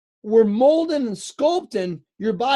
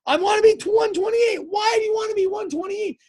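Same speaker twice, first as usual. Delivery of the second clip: much higher, worked-up retold exchange; exasperated mock argument; 11 semitones higher, faster than usual. Husband replaying weight argument with wife, exasperated. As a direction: re-enact a squabble in a raised pitch.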